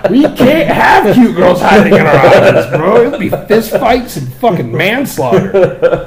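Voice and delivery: loud, slow laughing outburst; cracking up at the idea; laughing shout. Real, not acted. Laughing at cute girls idea.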